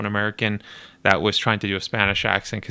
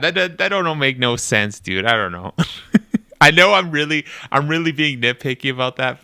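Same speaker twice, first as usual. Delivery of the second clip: ordinary talk, nothing marked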